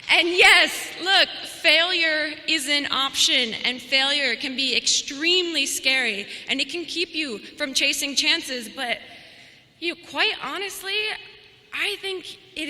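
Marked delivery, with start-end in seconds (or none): 0.1-6.3 big rallying push to the graduates; loud emphasis. Real, not acted. Speech peaks on failure point.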